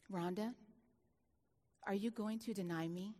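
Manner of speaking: low, soft and solemn; hushed voice of God. Retold or imitated. Preacher retelling God speaking to her, hushed and solemn. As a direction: say it like a holy whisper in her head.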